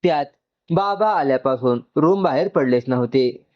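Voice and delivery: ordinary delivery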